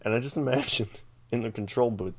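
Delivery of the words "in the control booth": usual speaking voice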